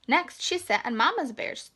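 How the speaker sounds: ordinary delivery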